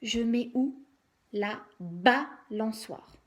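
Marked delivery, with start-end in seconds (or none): 1.3-3.1 "balançoire" slowly, BA set apart from lançoire; stress on first syllable. Shown as a demonstration. Teacher asking where it goes, "balançoire" as BA... lançoire. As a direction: lean hard on the BA of "balançoire". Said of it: "Bien insister sur la première syllabe".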